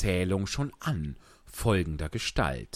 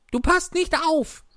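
high squeaky mouse scolding sharply; a strict little mouse telling her off; sternly; 7 dB louder than usual. A narrator's reading. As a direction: mouse scolding Alice for not listening. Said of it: "sagte die Maus strenge".

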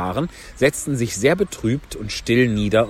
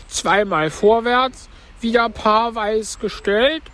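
high, slow turtle voice; Mock Turtle instructing; 11 semitones higher, slower than usual. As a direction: call the dance steps high and drawn out. (hohe, gedehnte Schildkrötenstimme)

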